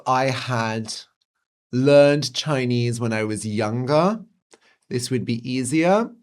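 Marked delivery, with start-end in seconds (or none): none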